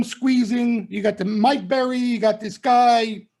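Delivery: ordinary talk, nothing marked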